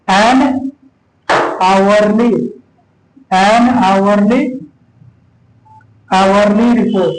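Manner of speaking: an hourly report drawn out, h silent as aʋarli; slow reading. Shown as a demonstration. Teacher reading an hourly report slowly to class. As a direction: read it slowly for students to repeat. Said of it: "आप पढ़ के देखिए इसे".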